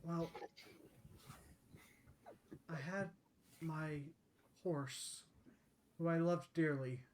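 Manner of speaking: halting, subdued confession; lightly voiced sorrowful centaur, pausing often; regretful centaur lady. Centaur lady tells her sad tale.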